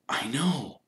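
hushed whisper in the dark. Acted. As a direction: whisper so the others sleep on. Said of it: "Keeping his voice so low not to wake Neville".

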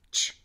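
チュ said as one clean ch-oo; merged chu sound. Shown as a demonstration. Teacher showing the merged sound, チュ. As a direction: blend t and y into チュ. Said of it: "とゆの二つの音が".

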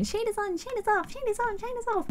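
high, soft singsong self-mockery; mocking herself. Streamer mocking her own repeated phrase, high singsong. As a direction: parrot yourself teasingly. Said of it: "I know I keep going".

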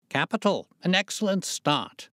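high, delighted exclamation; excited, high-pitched spectator; sudden cry. A narrator's reading. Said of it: "he cried suddenly".

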